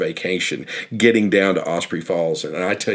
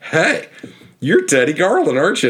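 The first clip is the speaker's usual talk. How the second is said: a much higher, brighter-pitched eager hail; excited recognition; 8 semitones higher, 4 dB louder than usual. Retold or imitated. Stranger at the truck recognizing the guide, excited. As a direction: call out as if you've just spotted a celebrity. Lady recognizes him at trailhead.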